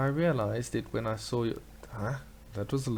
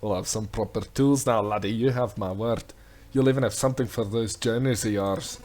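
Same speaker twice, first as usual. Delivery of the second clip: louder, booming Scottish sea-dog brogue; hearty Scottish smith; 5 dB louder than usual. Acted. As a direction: redo it as a big hammy Scottish smith. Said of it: "I'm having a little bit more fun".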